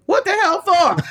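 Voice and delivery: a dad's startled bark down the phone; mock outraged dad; alarmed father.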